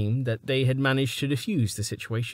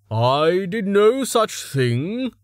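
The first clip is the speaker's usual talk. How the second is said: higher, louder and slow; indignant denial; 9 semitones higher, 5 dB louder, much slower than usual. A narrator's reading. Reason denying Stavo's claim, indignant. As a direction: deny it flatly, stung.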